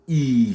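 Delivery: "I" held as a long ee; English long vowel "I". Shown as a demonstration. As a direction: draw out the vowel "I". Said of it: "c'est un I long".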